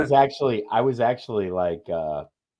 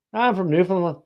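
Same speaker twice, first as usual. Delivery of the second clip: higher, a hint of Newfoundland lilt; lightly voiced Newfoundland man; Newfoundland accent; 9 semitones higher than usual. Guy in a Seoul bar answering, light Newfoundland accent. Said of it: "He's like".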